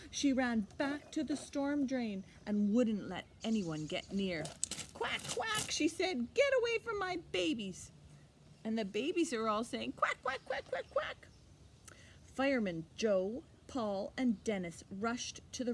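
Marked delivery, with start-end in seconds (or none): 4.9-7.9 much higher, fast, fierce mother duck; protective mother duck. A narrator's reading. Mama Duck defends her babies. 9.9-11.3 very high, tiny duckling quacks; baby ducklings quacking. A narrator's reading. Baby ducks quacking below.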